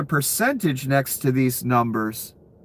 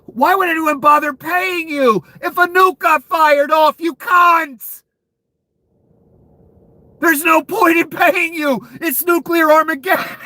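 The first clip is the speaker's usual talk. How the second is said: higher, louder shouting at the bettors; furious rant; 13 semitones higher, 4 dB louder than usual. Real, not acted. Streamer shouting at people betting on nukes. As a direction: yell it in disbelief at them.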